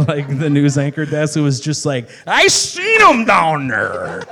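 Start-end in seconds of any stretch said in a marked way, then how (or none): 2.3-4.2 loud, slow, laughing Chicago drawl; thick Chicago accent. Shown as a demonstration. Guest demonstrating his cartoon Chicago news voice. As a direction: mash the words into pure Chicago. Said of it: "no consonants or vowels anymore".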